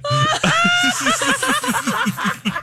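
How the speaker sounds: shrieking laughter; long high-pitched laugh; high laughing fit. Real, not acted. Burp cracks everyone up.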